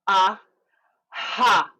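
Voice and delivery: "ah" popped, a pause, then "ha" on a gust of breath; "ah" and "ha" as two detached bursts; sticky then breathy. Shown as a demonstration. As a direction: separate clicky "ah" from airy "ha". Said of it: "one sticky and then one".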